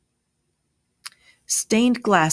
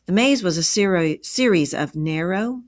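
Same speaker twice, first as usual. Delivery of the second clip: ordinary delivery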